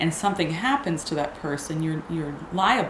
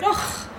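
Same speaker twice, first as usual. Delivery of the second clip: high mock groan; 13 semitones higher than usual. Coworker reacting to death risk.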